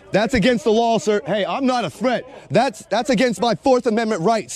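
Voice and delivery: ordinary delivery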